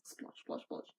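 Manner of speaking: voiceless splosh noises; storyteller's hushed splash sounds; whispered sound effect. Acted. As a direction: breathe the splashes out.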